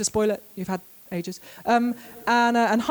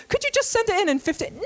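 much higher, sing-song pestering voice; mock publisher; 10 semitones higher than usual. Author mimicking the publisher asking for the dedication at the last minute, high and sing-song. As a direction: ask it as a breezy, pestering editor.

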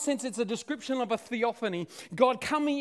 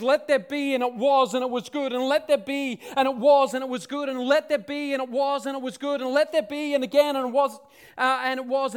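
loud, higher, driving repetition; rousing creation litany; 3 semitones higher, 7 dB louder than usual. Retold or imitated. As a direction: build it up like unstoppable creation. Acting out repeated creation commands, building excitement.

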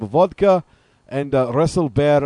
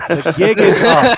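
laughing; 3 semitones higher, 6 dB louder, slower than usual. Real, not acted. Prank caller unmasked, host laughs.

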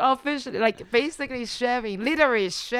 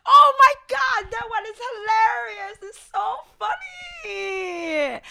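high-pitched gushing delight, drawing out the end; thrilled, hilarity bubbling over; delighted outburst; 11 semitones higher, 4 dB louder, slower than usual. Real, not acted. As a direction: squeal it like it's the funniest thing ever. Delighted recall of funny video.

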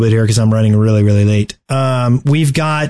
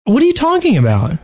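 mock disbelief; 11 semitones higher than usual. Mock outrage at question.

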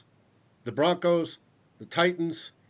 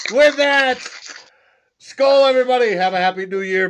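shouted sign-off; 9 semitones higher, 9 dB louder than usual. Real, not acted. Rousing sign-off to the audience.

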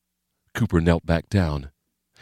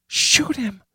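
tense whisper. A narrator's reading. Frightened woman urging in hiding.

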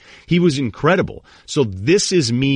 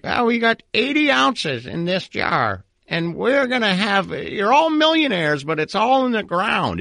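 put-on high, slow old prospector voice; Gold Rush grandfather impression; 12 semitones higher than usual. Podcaster mimicking the Gold Rush grandfather, high and slow. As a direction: ham up the grizzled grandpa counting gold. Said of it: "my Gold Rush impersonation".

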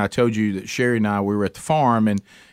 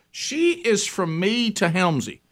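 ordinary delivery

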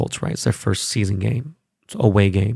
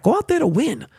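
fired up. Real, not acted. Ranting about Seahawks preseason.

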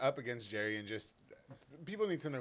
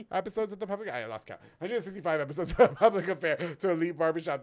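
ad read; 5 semitones higher, 9 dB louder than usual. Acted. Sponsor ad read, laughing.

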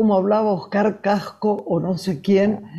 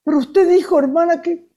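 put-on higher child's voice pleading with the nun; girl defending herself to the nun, higher; child answering the nun; 8 semitones higher than usual. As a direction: pipe up like a little schoolgirl explaining herself.

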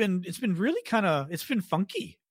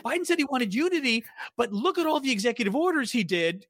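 mocking Republican voice; 6 semitones higher than usual. Mocking Republican talking point.

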